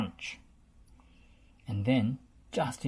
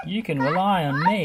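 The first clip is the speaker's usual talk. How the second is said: laughing; 4 semitones higher, 8 dB louder than usual. A narrator's reading. Scoop cheerful reply.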